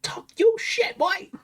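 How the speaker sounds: high, hyped-up squeal into a breathy whisper; excited hype. Real, not acted. Hyping guest's threat.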